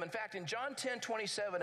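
ordinary delivery

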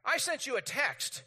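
mock annoyance at being ignored; acted-out exasperation; irritated. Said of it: "I get a little irritated 'cause I'm like".

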